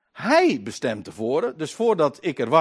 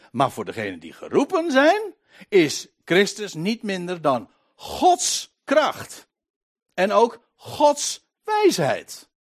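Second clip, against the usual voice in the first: slow, raised proclamation; emphatic preaching; 6 semitones higher, 6 dB louder, slower than usual. Real, not acted. Preacher telling the called who Christ is, emphatic preaching. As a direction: land each key word with weight.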